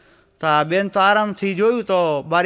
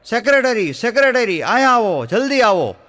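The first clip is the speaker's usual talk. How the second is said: loud urgent call, voice pitched higher; shouting for the secretary; 5 semitones higher, 5 dB louder than usual. Retold or imitated. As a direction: yell down the hall for the secretary. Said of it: "શેઠે બૂમ પાડી".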